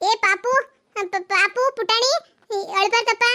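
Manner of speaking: sing-song soothing to a little one; lilting baby talk to calm the crying child; cooing to a baby. Acted.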